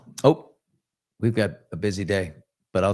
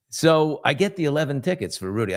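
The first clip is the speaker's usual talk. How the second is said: normal talking voice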